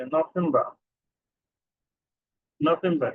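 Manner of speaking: নভেম্বর with an f sound for v; নভেম্বর, German style with f; German November. Shown as a demonstration. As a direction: say নভেম্বর as no-fem-ba. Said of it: "বি এর উচ্চারণটা ফয়ের মতো হচ্ছে".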